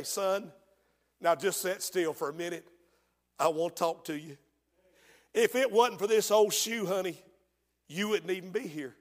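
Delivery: low, soft and serious mother; tender motherly talk. Acted. Ruth tells her son about the old shoe, tender and low.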